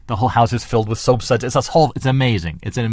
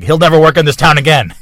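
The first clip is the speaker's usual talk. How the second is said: mock boss outburst; 5 semitones higher, 10 dB louder than usual. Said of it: "He's like".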